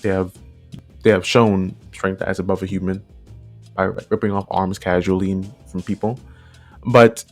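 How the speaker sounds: usual speaking voice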